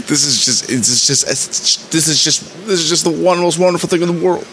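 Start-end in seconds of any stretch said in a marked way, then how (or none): 1.9-4.4 much higher and fast, nearly squealing with delight; gushing. Real, not acted. Host raving to listeners about the episode, giddy and excited. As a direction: let the delight spill over.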